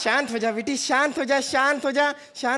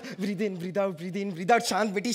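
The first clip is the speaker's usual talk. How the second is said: soothing breathing guide; 5 semitones lower, 6 dB quieter, slower than usual. Acted. Mother guides calming breaths.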